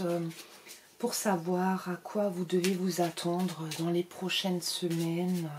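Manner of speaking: sweet-voiced card reader; changed voice. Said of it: "Je change de voix du coup".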